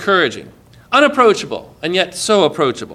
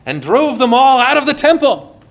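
raised, forceful preaching pitch; fiery; 7 semitones higher, 3 dB louder than usual. Retold or imitated. Telling how Jesus cleared the temple, fiery. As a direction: drive it out with zeal.

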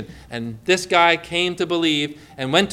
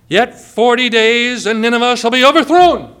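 prophet's proclamation; 3 semitones higher, 6 dB louder than usual. Retold or imitated. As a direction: call out the judgment like a prophet in the streets. Jonah's warning to Nineveh.

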